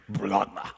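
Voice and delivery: splagma pushed up low from the gut; gut-level splagma. Shown as a demonstration. Preacher showing how splagma should sound, low gut sound. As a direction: growl splagma out from deep inside. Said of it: "That's what it's supposed to sound like".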